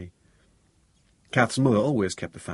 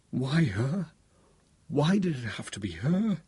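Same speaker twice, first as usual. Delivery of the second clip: higher-pitched, pleading lament; anguished questioning; 6 semitones higher, slower than usual. A narrator's reading. Bereaved man asking why her, anguished. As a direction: plead upward against the loss.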